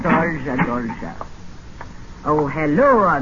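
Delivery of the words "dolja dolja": fake Russian accent. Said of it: "What happened to your Russian accent?".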